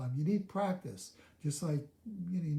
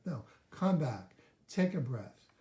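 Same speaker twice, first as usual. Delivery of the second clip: ordinary delivery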